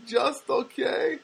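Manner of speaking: high, drawn-out questioning reply; mimicked Armenian girl. Girl questioning his answer, high mimicked voice. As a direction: stretch it slowly upward in her voice. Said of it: "she talks like this".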